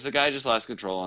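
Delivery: ordinary delivery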